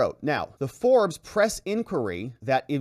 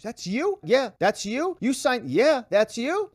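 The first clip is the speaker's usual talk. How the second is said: high, sing-song questioning; mocking repetition; 10 semitones higher, 3 dB louder, slower than usual. Mocking the attorney's repeated signature questions, high sing-song. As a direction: tease the lawyer's repetitive routine.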